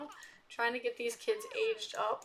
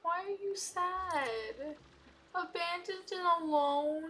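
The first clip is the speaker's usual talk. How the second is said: put-on 1 cooing baby talk; 6 semitones higher, slower than usual. Cooing to a sad Sim.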